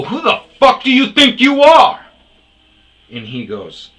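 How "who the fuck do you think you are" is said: high, loud furious yell. Retold or imitated. Narrator yelling at the old guy to back off, loud fury. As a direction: blast him with it. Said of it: "It was more yelling".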